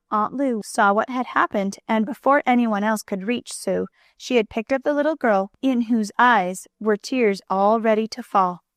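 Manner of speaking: ordinary delivery, nothing marked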